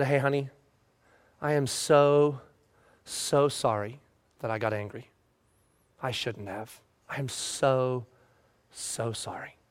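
barely-voiced sincere sorry; father gently apologizing to his crying child; tender apology. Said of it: "I just say this one thing".

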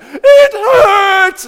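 very high, loud imitated wail; screaming, crying child. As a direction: wail it like a hurt five-year-old. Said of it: "he's screaming, and he's crying".

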